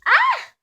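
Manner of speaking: sharp high cry of pain; pained yelp. Real, not acted. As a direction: yelp as chili hits the eye. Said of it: "I got some of the chili in my eye".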